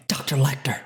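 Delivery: whispered film-heroine impersonation; Clarice Starling. Acted. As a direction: address the doctor in a hushed whisper. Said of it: "Clarice Starling impersonation".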